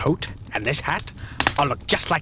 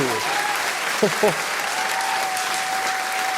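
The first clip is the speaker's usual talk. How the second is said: squeaky high laugh. Acted.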